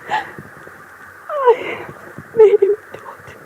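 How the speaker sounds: high, breathless whisper through tears; the voice gives out on the last words; broken whisper. Acted. Widow admitting to the investigator she made him surrender, whispering through tears.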